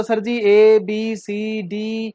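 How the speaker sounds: slow drawn-out mock recital of the alphabet; teacher imitating students reciting ABCD. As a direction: drag each letter like a student reciting. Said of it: "उनका कहना होता".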